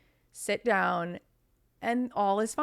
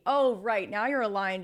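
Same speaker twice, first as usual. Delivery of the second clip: normal talking voice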